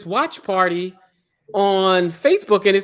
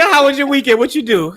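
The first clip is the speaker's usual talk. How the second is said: normal talking voice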